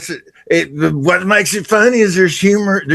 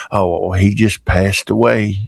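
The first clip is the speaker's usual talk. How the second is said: put-on low mournful old man; 7 semitones lower, slower than usual. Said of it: "Uh, what happened?".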